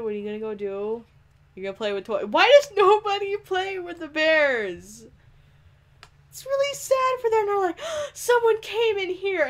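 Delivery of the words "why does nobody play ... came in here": high mock pity. Real, not acted. Player pitying unplayed teddy bears, high mock pity. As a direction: coo sadly over the bears.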